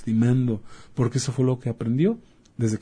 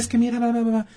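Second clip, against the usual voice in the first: much higher, hammed-up mother's nag; mock nagging mother; 11 semitones higher, faster than usual. Doctor imitating a mother yelling at her kid, much higher. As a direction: rattle it off like a fed-up mom.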